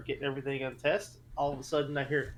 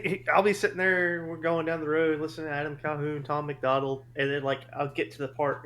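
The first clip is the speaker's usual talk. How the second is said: normal talking voice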